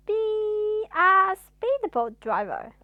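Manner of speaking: high, loud, slow drawn suggestion; laid-back suggestion. Acted. Mister Cool suggests.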